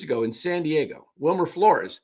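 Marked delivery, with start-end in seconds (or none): 1.2-2.0 announcer calling the game live; radio play-by-play. Shown as a demonstration. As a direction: call it like a radio broadcaster. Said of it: "Here's my attempt at calling a game".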